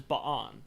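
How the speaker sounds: "Ba'an" broken in two, buh-AHN; two-syllable pronunciation. Shown as a demonstration. Explaining the name's two A's, two-syllable pronunciation. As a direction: split the double A in "Ba'an". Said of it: "two A's next to each other".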